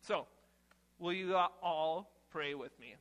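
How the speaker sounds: ordinary talk, nothing marked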